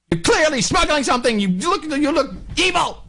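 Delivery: high mock yell of suspicion; mock accusation. Guard yelling at a traveller, hammed-up high accusation. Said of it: "This is how I feel".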